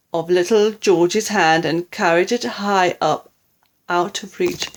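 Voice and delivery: plain reading voice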